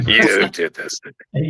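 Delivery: slow mock demon, words stretched; demonic voice. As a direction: do the possessed-wife voice slowly. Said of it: "In a demonic voice".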